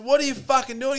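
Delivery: high, loud demand, caught off guard; shocked guy yelling at a stranger on his bed; retold shout. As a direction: bark the question at the intruder. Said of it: "I said".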